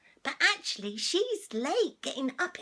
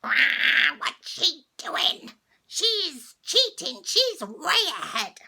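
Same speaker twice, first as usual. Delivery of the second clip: raised, slow shrieking complaint; angry witch cries; 3 semitones higher, 8 dB louder, slower than usual. A narrator's reading. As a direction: cry out furious at the cheater. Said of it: "the horrid cries of anger from the witches behind".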